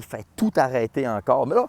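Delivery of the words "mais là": usual speaking voice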